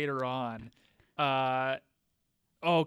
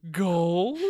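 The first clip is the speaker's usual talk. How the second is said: louder mock-excited question; Laura; 2 semitones higher, 5 dB louder than usual. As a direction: guess eagerly with rising pitch. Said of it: "you can be Laura".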